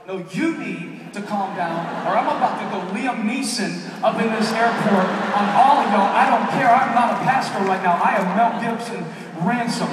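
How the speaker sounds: slow, then a loud furious yell; furious shout. Retold or imitated. Father yelling at airport security staff, furious shout. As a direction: blow up at them, all restraint gone.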